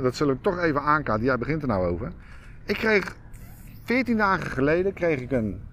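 ordinary delivery

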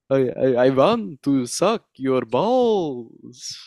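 slow, drawn-out lusty vampire; horny vampire voice. Acted. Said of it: "when I do the horny vampire voice".

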